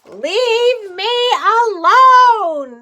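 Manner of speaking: loud high cry to be left alone; shouting. Acted. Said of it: "the old woman shouted".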